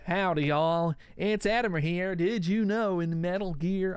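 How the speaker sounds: slow, drawn-out cowboy twang accent; Southern cowboy accent. Shown as a demonstration. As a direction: put on a hammy Texan-style cowboy accent. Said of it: "change my accent completely".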